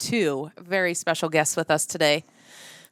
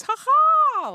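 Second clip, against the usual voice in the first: high loud mock triumphant whoop; gloating cheer; 5 dB louder than usual. Host imitating the Eagles fan gloating around the office. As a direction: crow like a big winner. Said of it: "He walks around the office".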